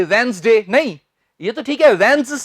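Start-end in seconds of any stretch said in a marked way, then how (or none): 0.0-0.7 वेडनेसडे as quick wenz-de, short final vowel; abrupt wrong ending. Retold or imitated. Teacher shows what not to say, वेडनेसडे with abrupt short de. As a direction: cut वेडनेसडे off at de, no glide. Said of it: "ये part तो आप बोल देंगे".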